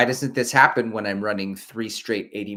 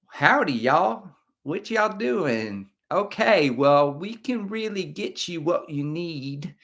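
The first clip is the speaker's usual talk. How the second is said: put-on slow, higher Texas accent, stretched vowels; Texas drawl; 3 semitones higher, slower than usual. Vlogger imagining a friendly Texan local, slow Texas accent. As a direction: play a slow-talking Texan greeting you. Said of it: "That's what I want from Boston".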